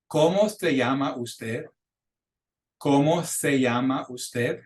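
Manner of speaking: ¿Cómo se llama usted? slowly with pure vowels; model pronunciation. Shown as a demonstration. Teacher pronouncing words for students to repeat, ¿Cómo se llama usted? slowly. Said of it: "gonna pronounce those words for you".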